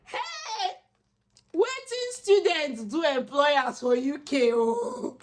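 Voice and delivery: high, drawn-out mock wail; mock lament. Student pleading with UK employers, mock lament. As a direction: wail it slowly, high-pitched, in exasperation.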